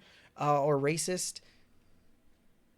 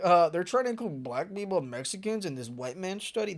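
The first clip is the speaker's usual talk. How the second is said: mocking dumb voice; 3 semitones higher than usual. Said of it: "That's how you idiots sound".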